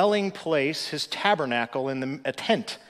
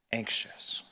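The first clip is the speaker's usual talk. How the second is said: soft whispered word, no voice at all; 3 semitones lower, 7 dB quieter than usual. Real, not acted. Pastor comforts anxious listeners, whispered. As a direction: breathe the word out as a hush. Gentle reassurance ending the point.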